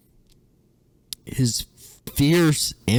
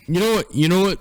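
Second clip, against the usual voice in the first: exasperated outburst; 13 semitones higher, 6 dB louder, much faster than usual. Real, not acted. Exasperated outburst mid-reading.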